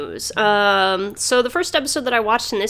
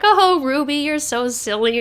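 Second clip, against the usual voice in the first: higher, slow mock-affectionate teasing; teasing rubies; 6 semitones higher than usual. Host voicing the rubies teasing their friend. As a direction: coo it like teasing a silly friend. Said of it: "they're like".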